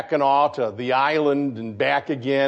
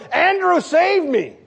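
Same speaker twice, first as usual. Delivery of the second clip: mock cry for help; 13 semitones higher, slower than usual. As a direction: call out like a sinking man. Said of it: "He doesn't cry out to Andrew".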